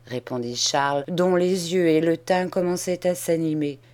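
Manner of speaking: plain reading voice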